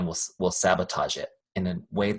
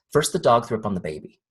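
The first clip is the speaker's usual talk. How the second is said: ordinary talk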